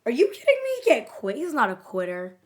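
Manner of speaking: incredulous outburst, voice leaping up high; hammed-up protest, loud then easing off; loud acted denial. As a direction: imitate someone yelling in disbelief. Acting out denial again in hallway.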